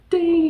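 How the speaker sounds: high, ringing ding; high-pitched bell imitation; imitated little ding. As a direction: chime it like a tiny bell. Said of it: "there's a little ding sound".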